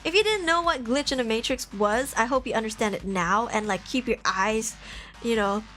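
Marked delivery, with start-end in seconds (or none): none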